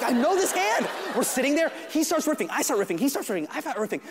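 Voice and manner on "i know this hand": awed recognition. Retold or imitated. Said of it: "I was like".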